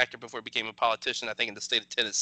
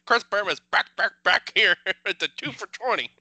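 loud, higher sportscaster impression, breaking into laughs; Chris Berman; 5 semitones higher, 6 dB louder than usual. Podcast host doing requested Berman catchphrases, hammed-up sportscaster call. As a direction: boom it out like a highlight-reel announcer. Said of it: "Chris Berman, uh, impressions".

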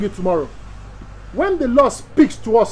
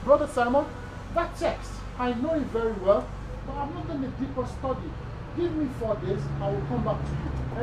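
soft, gentle model answer to a member; humble admission; 4 semitones higher, 8 dB quieter than usual. Shown as a demonstration. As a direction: show them how to say you'll study first, quietly. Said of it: "when you say".

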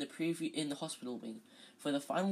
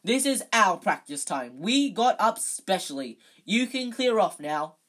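very loud, high bellow; captain bellowing at the Slytherin captain; 4 semitones higher, 10 dB louder than usual. Acted. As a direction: roar the name across the pitch. Captain furious at rival.

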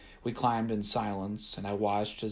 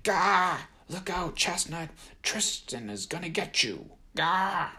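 hammed-up brawler yelling; shouting; 9 semitones higher than usual. A narrator's reading. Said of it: "shouting and throwing a few punches".

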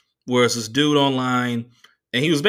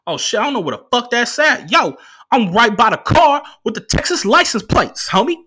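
high, loud, animated driver voice; mock Uber driver; 11 semitones higher, 7 dB louder than usual. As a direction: holler directions down the phone like a hapless driver. Imitated driver shouting directions by phone.

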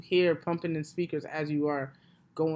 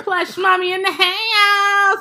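loud, drawn-out, much higher hype call; hype entrance; 12 semitones higher, 13 dB louder, much slower than usual. Real, not acted. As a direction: announce yourself like a star. Grand entrance announcement.

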